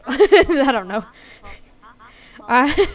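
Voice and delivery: loud burst of real laughter through the words; helpless giggle over the words; laughing. Real, not acted. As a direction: crack up while trying to talk. Cracks up at own attempt.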